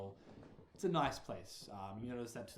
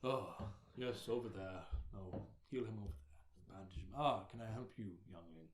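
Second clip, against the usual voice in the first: lightly voiced older cleric, unhurried; a hint of a deep-voiced old gentleman giving orders; deep-voiced head cleric. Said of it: "with a deep voice".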